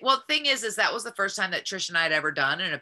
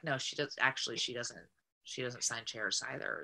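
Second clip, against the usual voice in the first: flat, quiet, dead-straight delivery; a mock-serious aside with no smile; deadpan; 6 semitones lower, 11 dB quieter than usual. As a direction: say it with a totally straight face, softly. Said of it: "Totally straight face too".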